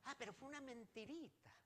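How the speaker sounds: high, soft little minimizing voice; mock excuse. Preacher imitating someone excusing a little lie, high soft mock excuse. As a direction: lift and soften it, imitating someone playing innocent.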